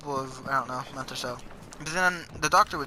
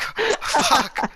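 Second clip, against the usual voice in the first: high, louder startled curse; shocked curse; 11 semitones higher, 5 dB louder than usual. Retold or imitated. Retelling the moment a classmate threw a chair, startled high curse. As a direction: blurt it out as the chair flies. Retold reaction to thrown chair.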